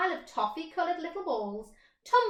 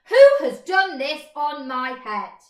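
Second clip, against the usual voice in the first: loud, outraged demand; indignant shout; 4 semitones higher, 15 dB louder than usual. Acted. Mole shouting about the mess on his head. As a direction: shout it up at the sky.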